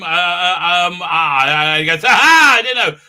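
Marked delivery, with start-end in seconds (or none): 2.0-3.0 gloating shout. Retold or imitated. Villain gloats while whacking Seven.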